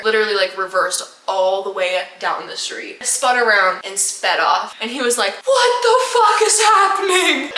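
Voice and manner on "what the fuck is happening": high, loud bewildered outcry; alarmed mock driver. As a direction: imitate a freaked-out driver demanding answers. Driver panicking after escape.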